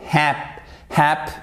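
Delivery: short HAP cut off at the P; syllable closed on P. Shown as a demonstration. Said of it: "la première syllabe, elle va jusqu'au P".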